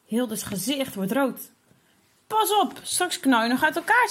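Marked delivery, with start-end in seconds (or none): 2.3-4.1 high, loud teasing jab; boy teasing his red-faced sister, high and loud; taunting. Acted. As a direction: needle her, sing it out high and loud.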